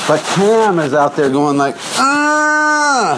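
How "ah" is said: high, sustained wailing moan; Cam's moan. Retold or imitated. Host mimicking quarterback out there calling signals, high held moan. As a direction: hold one high note like a moaning singer. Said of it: "he'll moan when he calls the signals".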